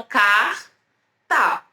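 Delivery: "carta" as car, pause, ta; R e T separados. Shown as a demonstration. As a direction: pronounce R and T apart in "carta". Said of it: "e o T separadamente". ("carta" com o R alongado e o T separado)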